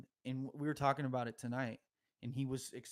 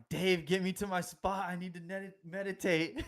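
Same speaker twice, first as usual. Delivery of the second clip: urgent plea; 6 semitones higher, 5 dB louder than usual. Retold or imitated. Tripping, urging friend to guide.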